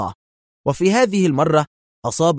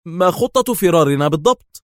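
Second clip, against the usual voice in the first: higher-pitched, sudden demand; an abrupt, raised-pitch query; abrupt question; 5 semitones higher, 4 dB louder than usual. A narrator's reading. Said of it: "وسأله بغتة".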